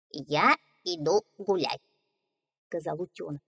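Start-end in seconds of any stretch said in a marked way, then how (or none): 0.1-1.8 cheerful little duckling. Acted. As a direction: voice the young duckling brightly. Duckling speaks.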